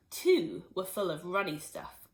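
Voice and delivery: usual speaking voice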